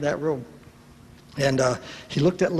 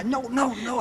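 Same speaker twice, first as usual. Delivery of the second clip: frantic no; 8 semitones higher than usual. Retold or imitated. Husband frantically signals wife.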